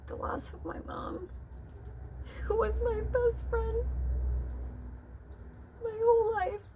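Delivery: high, tearful voice breaking; crying. Real, not acted. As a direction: speak through real tears about mum. Grief about her mother.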